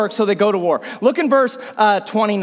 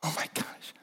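whispered aside; 19 dB quieter than usual. Acted. Aside about digging a hole.

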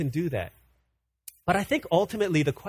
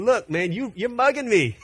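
laughing through "you're mugging me"; a chuckling, buddy-like complaint; casual God voice; 5 semitones higher than usual. Retold or imitated. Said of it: "So God is saying".

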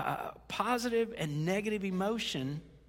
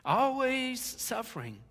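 "Always suffering" higher, louder and slow, punched in disbelief; loud emphatic; 6 semitones higher, 4 dB louder, slower than usual. Shown as a demonstration. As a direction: hit "Always suffering" hard and high.

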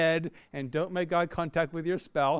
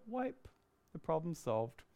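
calm; 10 dB quieter than usual. Retold or imitated. Calm response to spilled milk.

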